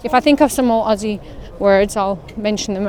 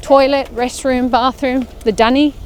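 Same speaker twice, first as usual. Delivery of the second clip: slow, clear teaching; 3 semitones higher, 3 dB louder, slower than usual. Shown as a demonstration. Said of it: "Dunny means".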